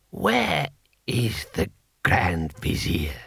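put-on very slow, low old ruler; ancient emperor. Emperor summoning his Grand Vizier, slow low voice. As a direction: drag each word out, low and halting.

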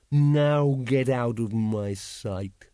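very slow, menacing dragon command; imperious dragon, dismissive. A narrator's reading. Dragon dismissing its servant from its sight, imperious. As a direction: stretch the words out as a haughty dragon.

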